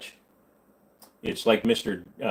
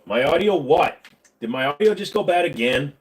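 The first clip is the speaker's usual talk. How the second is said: louder, higher incredulous question; indignant disbelief; 4 semitones higher, 4 dB louder than usual. Real, not acted. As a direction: throw it back in disbelief, louder. Startled by comment about his audio.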